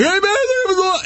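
very high-pitched mock yell; imitated yelling. Buddy yelling while the cop holds them, imitated yell. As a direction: holler it like the noisy buddy. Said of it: "was yelling the whole time".

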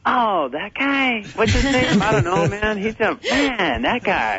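slow, high, vague puzzling over a name; drawn-out head-scratching voice; lightly voiced fan who can't place him; imitating puzzled mourners. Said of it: "people go".

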